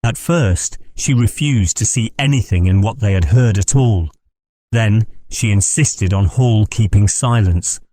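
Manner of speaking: ordinary delivery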